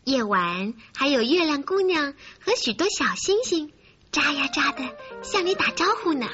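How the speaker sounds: ordinary delivery, nothing marked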